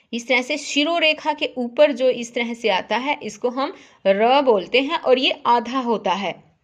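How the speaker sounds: ordinary delivery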